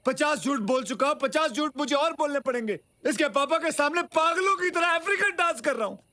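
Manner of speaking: high, worked-up complaining; exasperated rant. Acted. Man sick of his own lies, exasperated.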